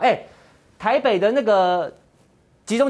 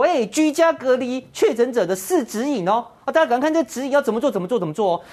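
mocking the official; 6 semitones higher than usual. Mocking the official's guideline briefing, sing-song mock voice. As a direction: lightly imitate a fussy official reading out guidelines. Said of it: "你庄人祥还出来说".

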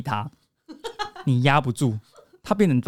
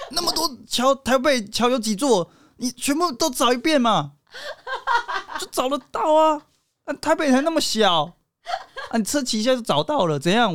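high, laughing mock-whiny girlfriend; a playful girlfriend scolding her boyfriend back, pitch up; sassy girlfriend; 8 semitones higher than usual. Girlfriend teasing boyfriend who can't find her, high mock whine. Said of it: "然后如果是你就说".

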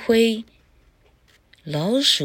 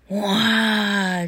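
higher, drawn-out wow; a long wow of amazement; storyteller's wonder; 4 semitones higher than usual. A narrator's reading. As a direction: marvel at a magic transformation. Storyteller marvels at the magic.

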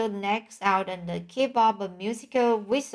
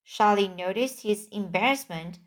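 plain reading voice

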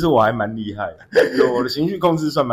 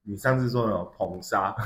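usual speaking voice

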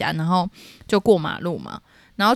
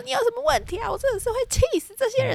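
much higher, fast furious rant; retold angry yell; faster than usual. Daughter ranting to her calm mother, high and fast. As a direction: vent at full pitch like a hothead. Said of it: "我对着她大吼".

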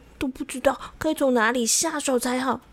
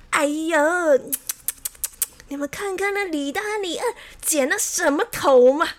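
higher, scornful storybook sneer; mocking; 6 semitones higher, 4 dB louder than usual. A narrator's reading. Xiao Wang mocks rival barbers behind their backs, scornful. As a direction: ridicule rivals with exaggerated disdain. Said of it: "他在背后不断地嘲笑李大和李二".

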